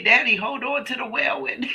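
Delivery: put-on high child voice, breaking into laughter; imitating a toddler, on the edge of laughing; little boy's mispronunciation. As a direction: pitch it up like a small boy with W for L. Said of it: "He used to say".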